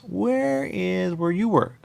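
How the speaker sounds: high, slow, drawn-out puzzled question; puzzled asking. Retold or imitated. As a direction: stretch the question out, baffled. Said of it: "I asked once".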